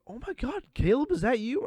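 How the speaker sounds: gushing customer. Retold or imitated. A customer recognizing the waiter, high and gushing. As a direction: gush like you found an old friend. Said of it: "this woman come and go".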